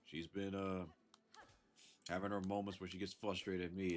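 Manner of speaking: normal talking voice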